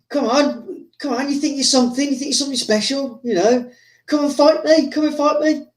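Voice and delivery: high-pitched mock goading; retold challenge. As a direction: goad a rival into a fight, acted out. Retold fighter challenges film star.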